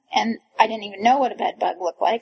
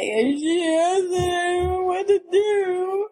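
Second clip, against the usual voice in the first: high, drawn-out tearful wail; hammed-up helpless blubbering; mock crying; 8 semitones higher, much slower than usual. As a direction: wail it slowly as if sobbing. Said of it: "and I'm crying".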